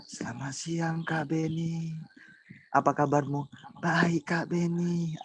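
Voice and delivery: slow, drawn-out polite student; slow mock polite greeting; imitating Nidar. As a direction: stretch each word like a slow shy student. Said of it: "kalau Nidar".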